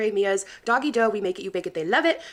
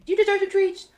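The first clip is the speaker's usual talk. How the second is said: high cooing pet talk; talking to the dog; 11 semitones higher, 4 dB louder than usual. Pretend. Praising her dog Bruno, high cooing pet voice.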